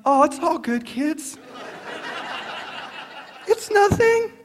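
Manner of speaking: high, falsely sweet reassurance; fuming, feigning calm. Acted. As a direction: cover fury with a sing-song all-is-well. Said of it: "And I'm fuming".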